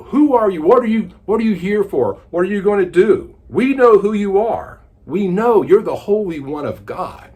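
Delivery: loud, high, frantic demon cry. Acted. Demon in the man crying out at Jesus, loud and frantic. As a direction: shout at the intruder in panic. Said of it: "he begins crying out".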